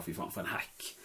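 soft, toneless aside; mock dismissal under the breath; muttered scorn. The type who writes off an actor, imitated in a scornful mutter.

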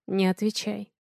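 hushed, understated man's line; whispering. A narrator's reading. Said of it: "шепчет Василий".

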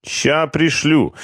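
high, slow grudging answer; scared foreman answering grudgingly; hostile reply. A narrator's reading. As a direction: answer with dislike. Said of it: "неприязненно отвечал бригадир".

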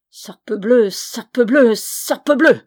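loud curse, climbing with each repeat; grumbled oath. A narrator's reading. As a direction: build the triple oath to a high outburst. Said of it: "grommela-t-il par trois fois".

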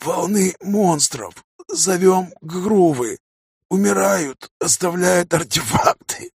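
coughing dying voice. A narrator's reading. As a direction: gasp out each word, retching. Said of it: "кашель почти после каждого слова". (с кашлем, умирающим голосом)

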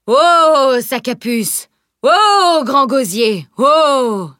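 loud, drawn-out high whoas; appel aux chevaux. Acted. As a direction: holler to halt the horses. Carter halts his horses, calling out. (cris sonores et traînants de charretier)

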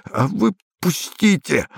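high, strained hiss; hissed plea. A narrator's reading. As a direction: hiss to be let go. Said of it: "прошипел солдат".

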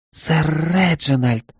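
a startled maid, stretching the name; gasping maid. Acted. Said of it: "Она ахнула".

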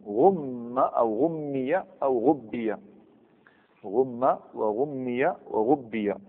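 "غم" "غمي" "غبي" spelled out slowly, each word apart; slow demonstration. Shown as a demonstration. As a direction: dictate each form slowly to the class. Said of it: "وش تقول؟". (نطق بطيء وواضح لكل صيغة)

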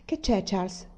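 a touch of worry in the voice; wife suddenly uneasy; worried question. A narrator's reading. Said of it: "chiese preoccupata".

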